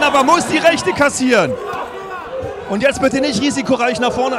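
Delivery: aufgeregt. Real, not acted. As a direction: react sharply to the hit. Treffer im Kampf, aufgeregt. (aufgeregter Ausruf)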